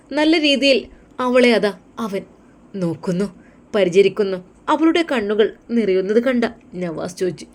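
normal talking voice

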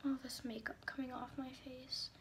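soft whisper while wiping off makeup; hushed self-talk. Real, not acted. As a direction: whisper it to yourself. Quiet aside while wiping makeup.

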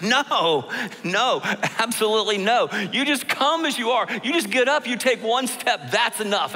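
lightly voiced Jesus, pleading hard; emphatic Jesus. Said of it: "Jesus emphatically says".

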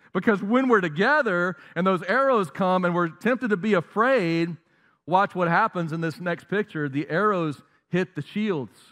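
ordinary talk, nothing marked

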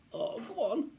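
high-pitched wheedling; pleading boy. Acted. Child begs friend to include him, pleading. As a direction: beg a reluctant friend.

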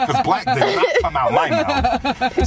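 high, slow, words breaking into laughter; indignant but cracking up; laughing protest. Real, not acted. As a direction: protest through real laughter. Ranting through laughter about wrong colour.

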